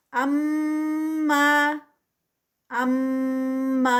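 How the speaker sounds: اما with ghunna on ام and a clean two-count ما; tajweed recitation. Shown as a demonstration. Teacher models اما for students in recitation. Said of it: "پہلے غنہ ہے ام پہ".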